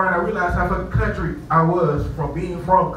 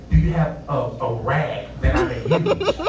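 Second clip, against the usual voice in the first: slowed, overly careful polite ask; slow proper request; 4 semitones lower, slower than usual. Retold or imitated. Guest re-asking the desk clerk for a rag, slow and proper. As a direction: drag it out like a gentleman. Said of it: "I'm saying it improperly".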